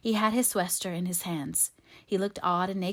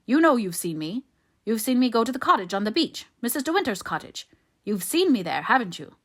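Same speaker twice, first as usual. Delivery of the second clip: loud, raised-pitch bullying; rough; 4 semitones higher, 7 dB louder than usual. A narrator's reading. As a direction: badger him roughly for an admission. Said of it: "said Favell roughly".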